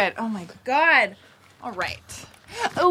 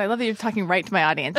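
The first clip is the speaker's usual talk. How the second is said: ordinary talk, nothing marked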